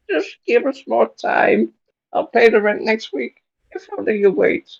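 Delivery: put-on old woman voice. Aunt May begging the landlord for time, high old woman voice. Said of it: "Why does Aunt May sound like the Joker".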